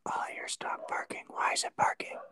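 hushed whisper at the dog. Real, not acted. Owner whispers at her barking dog during the recording, hushed. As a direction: keep it voiceless, hushing.

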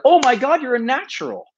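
high, impressed gush of a fan; gushing praise. Retold or imitated. Fans online praising his video, high excited gush. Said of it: "people are like".

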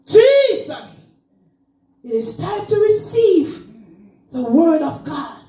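high, half-sung preaching climb; full-force preaching. Acted. Preacher pressing listeners to receive the word, rising half-sung call. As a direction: lift it back up into the whoop.

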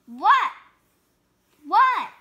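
high, loud startled cries; startled what. Acted. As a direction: jump at the accusation. Pigeon startled.

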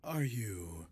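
ordinary talk, nothing marked

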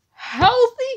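squeaked high in disbelief; shocked disbelief. Acted. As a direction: hit the top of your range. Repeats the word in disbelief.

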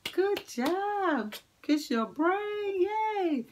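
high squeaky praise; broad singsong kids theatre. Acted. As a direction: cheer them on in a high voice.

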